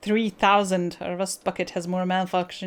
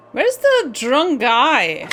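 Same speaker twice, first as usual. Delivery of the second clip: loud, slow, swooping angry demand; 5 semitones higher, 9 dB louder, much slower than usual. Acted. Player demanding to know where the drunk guy is, angry. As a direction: demand it, stretching the words out loudly.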